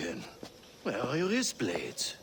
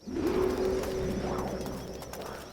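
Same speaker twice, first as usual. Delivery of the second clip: high breathy cackle; sly high cackle; gloating laugh; 4 dB quieter than usual. Acted. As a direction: cackle over your trophies. Collector gloats over stolen blades.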